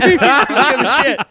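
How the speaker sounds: high, loud, slow shout; defiant shout. Retold or imitated. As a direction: yell it like you don't care. S2 acting out Logan at the drive-through.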